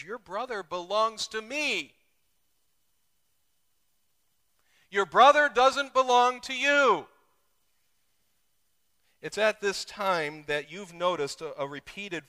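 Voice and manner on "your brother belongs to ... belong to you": loud, high kingly declaration; the king speaking. Acted. King declares the brother belongs to him, loud. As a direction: claim the brother as his own. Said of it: "but he says".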